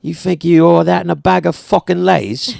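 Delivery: put-on British tough-guy voiceover accent; Jason Statham. Mock snack voiceover, British hard-man accent. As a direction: menace the listener in a British accent. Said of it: "Voiceover, Jason Statham".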